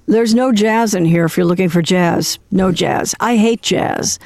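ordinary talk

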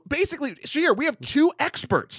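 high, eager and emphatic; excited. Real, not acted. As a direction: hype up the two experts. Excited pitch about guests.

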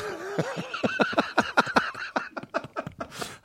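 very high, helpless laugh; laughter. Real, not acted. Comedian cracks up at his own accent bit, laughing. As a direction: break up in high-pitched giggles.

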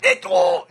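very high strained scream; pained scream. Retold or imitated. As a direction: shot twice, shrill cry of pain. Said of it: "all the screaming".

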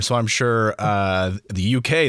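ordinary talk, nothing marked

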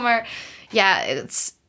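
usual speaking voice

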